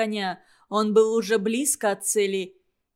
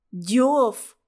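a croaked cry of fright; hoarse croak; 4 dB louder than usual. Acted. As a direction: rasp the word out hoarsely. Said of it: "прохрипел другой". (хриплый вскрик)

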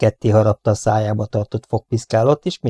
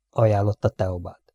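ordinary delivery

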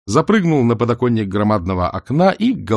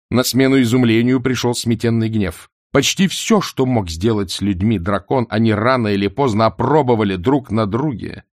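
ordinary delivery, nothing marked

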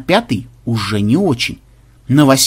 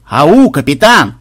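loud, slow, drawn-out hail; calling out; 6 dB louder, slower than usual. Acted. Pirate hailing the dazed captain, loud drawn-out call. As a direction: call out to rouse someone stunned. Окликает оглушённого капитана.

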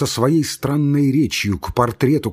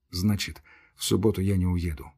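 softer, quick private thought; inner thought; 4 semitones lower, 6 dB quieter than usual. Acted. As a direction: murmur it to yourself, fast. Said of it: "мелькнуло у него в мыслях".

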